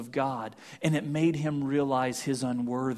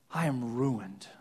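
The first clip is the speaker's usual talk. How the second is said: quiet despair; 5 semitones lower, 6 dB quieter, slower than usual. A narrator's reading. Isaiah's despair, dropped low.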